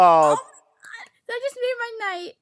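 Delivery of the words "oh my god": loud, slow, laughing exclamation; laughing in delight. Real, not acted. Stranger delighted by the rap, laughing loudly. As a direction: let the laugh carry the words.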